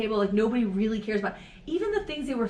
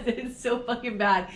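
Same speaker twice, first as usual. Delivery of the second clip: usual speaking voice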